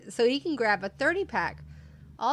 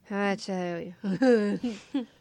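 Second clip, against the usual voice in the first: put-on slow slurred drunk voice; slower than usual. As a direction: drag the words like a drunk. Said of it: "So I'm saying this in a drunk voice".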